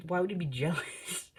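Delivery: high, giggling mid-line; laughing through words. Retold or imitated. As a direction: break into a laugh. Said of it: "she goes".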